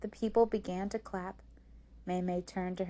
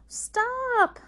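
high, loud drawn-out shout; crying out; 10 dB louder than usual. A narrator's reading. Rose begs her sister to quit, crying out. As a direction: cry out in protest. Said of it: "cried Rose".

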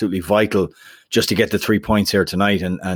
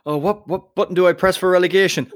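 higher-pitched, flustered operator voice; mock panic; 8 semitones higher than usual. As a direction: play a panicked button-pusher, hammed-up. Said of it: "the guy panicked and he's like".

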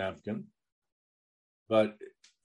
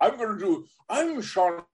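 Sean Connery impression; 8 semitones higher than usual. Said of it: "do the entire next show as Sean Connery".

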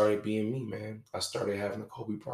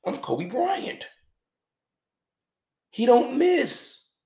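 higher, louder and slow, full of swagger; mock cocky boast; 11 dB louder, much slower than usual. Mocking his old self to listeners, cocky boast. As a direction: puff it up like a superstar.

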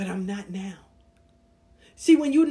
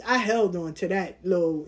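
ordinary talk, nothing marked

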